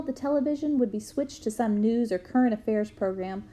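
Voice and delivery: plain reading voice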